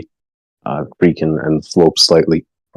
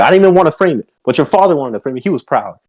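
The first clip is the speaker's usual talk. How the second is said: higher, exasperated mother; indignant mom; 7 semitones higher than usual. Acted. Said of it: "she'll be like".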